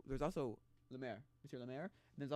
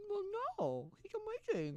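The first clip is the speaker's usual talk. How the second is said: much higher, put-on voice of a gushing fan; mock fan gushing. Fan of the musical defending Francine, in a mock gushing voice. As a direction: squeak it out like a starstruck fan.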